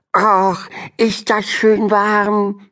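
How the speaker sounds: high, slow, pleased little turtle voice; delighted turtle. A narrator's reading. Turtle Henrietta in the warm pool, high and delighted. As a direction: sink into the warm water with delight. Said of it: "freute sie sich".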